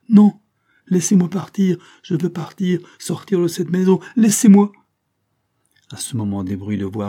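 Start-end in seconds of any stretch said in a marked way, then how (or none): 0.1-4.7 high, loud pleading insistence; desperate protest. A narrator's reading. Captive girl to the count, desperate loud protest. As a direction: burst out, demanding release.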